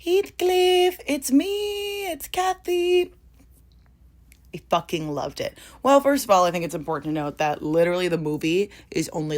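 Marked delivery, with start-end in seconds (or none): 0.0-3.1 much higher, slow, theatrical Cathy calling out; dramatic Wuthering Heights heroine voice; mock Cathy. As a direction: play the yearning heroine, hammed up.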